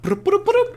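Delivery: very high power-up noise imitation; rising cartoony broop sounds; Mario. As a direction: imitate a video game power-up, high and loud. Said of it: "like a little Mario".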